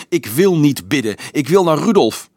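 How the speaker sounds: high, loud, defiant shout. A narrator's reading. Marieke refuses the priest's call to pray, shouting. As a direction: shout the refusal with full insistence. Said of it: "schreeuwde Marieke".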